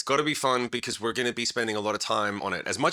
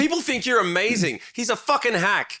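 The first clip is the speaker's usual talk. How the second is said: much higher, worked-up comic disbelief; mock outrage; 9 semitones higher, 3 dB louder than usual. Singer exposing his bandmate's trick to the listeners, mock-outraged. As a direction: rib him in high, incredulous outrage.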